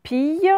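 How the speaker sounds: "Pille" drawn out, like end of grappille; syllable reading. Shown as a demonstration. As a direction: read "Pille" alone with a y glide. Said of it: "comme, euh, il grappille".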